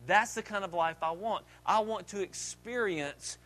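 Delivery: hushed personal confession, unhurried, with weighty pauses; heartfelt declaration. Real, not acted.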